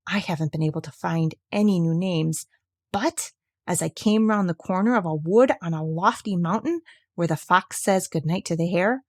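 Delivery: ordinary delivery, nothing marked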